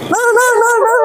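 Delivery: high, urgent repeated name call; alarmed calling. Real, not acted. Friend warns the learner rider to go slowly, alarmed.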